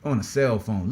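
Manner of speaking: ordinary talk, nothing marked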